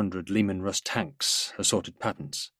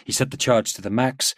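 ordinary delivery, nothing marked

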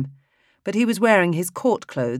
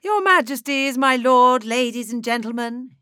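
much higher, sing-song theatrical conductor; high, mellifluous voice; 9 semitones higher, 3 dB louder than usual. Acted. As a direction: croon the greeting like a showman. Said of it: "he said in a high, mellifluous voice".

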